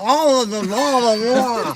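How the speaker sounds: loud, high imitation of talking up in the nose; mock nasal talk. Mocking the Nova Scotian guy she couldn't take, exaggerated nasal voice. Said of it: "He talk up in his nose".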